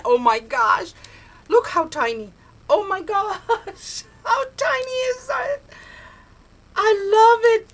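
high, loud delighted squeals; thrilled squealing. Real, not acted. Crafter gushing to friend Tips over handmade die cuts, real squealing delight.

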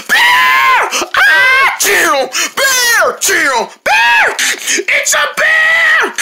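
high-pitched, drawn-out squealing cries; play-acted panic. Turtles spot the bear attacking, panicked. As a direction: squeal in cartoon terror.

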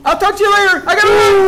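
high, shouted hasty sign-off; panicked. Acted. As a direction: yell it as you flee. Flees from the lost mice.

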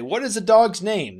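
bright curious question; eager question. Shown as a demonstration. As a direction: ask it like an eager kid. Teacher voices a question quote.

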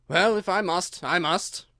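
mildly melancholy, a touch high; reluctant, mock-gloomy resignation; melancholy air. A narrator's reading. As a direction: give in with a mournful air. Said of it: "with a melancholy air".

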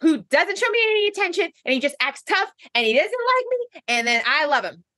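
high-pitched character voice, stretched out; hammed-up lovestruck woman; Sonia impression. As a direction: gush in a high put-on voice. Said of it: "That was a really good, you're getting so good at it".

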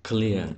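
"Clear" with the vowel gliding from I into E; diphthong I-E in "Clear". Shown as a demonstration. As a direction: draw out the I-E glide in "Clear". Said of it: "ta phiên âm là I E nhé".

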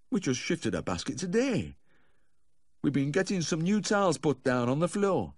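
high, fretful character voice; doubtful owner. A narrator's reading. Dog owner explaining the moved basket to the vet, doubtful. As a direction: explain it high-pitched and unconvinced.

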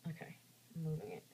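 fast, soft whisper. Real, not acted. Moving the lizard gently, a quick whisper. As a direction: whisper so as not to startle it.

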